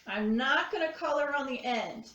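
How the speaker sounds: ordinary delivery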